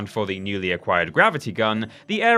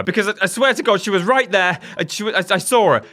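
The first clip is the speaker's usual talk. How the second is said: panicked; 9 semitones higher, 4 dB louder, faster than usual. Pretend. Frantically searching for ghost girl.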